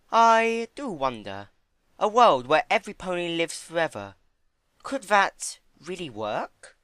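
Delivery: a refined mare savouring a big idea; Rarity wondering. A narrator's reading. Rarity voiced, wondering aloud.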